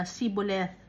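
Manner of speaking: "Siboleth" with plain S, no H; "Siboleth" starting see, not shee; Ephraimite pronunciation. Shown as a demonstration. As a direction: say "Siboleth" with S replacing SH. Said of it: "ang pagpo-pronounce sila".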